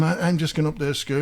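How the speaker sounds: ordinary talk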